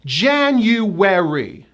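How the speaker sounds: January slowly as JAN-you-wer-ee; January, drawn out and falling; four syllables. Shown as a demonstration. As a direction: stress JAN, clear Y and W in January. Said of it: "a hidden Y and a hidden W".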